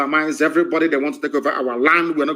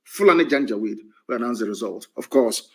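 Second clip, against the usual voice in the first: ordinary talk, nothing marked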